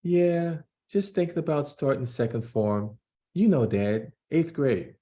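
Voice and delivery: put-on a kid telling his dad about school worries; young Jordan voice. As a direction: play the nervous twelve-year-old son. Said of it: "I'm gonna try to do voices to distinguish".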